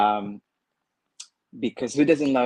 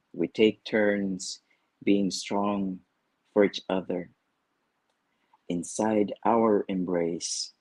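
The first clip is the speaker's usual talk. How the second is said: poem reading. Acted. Reads own poem aloud.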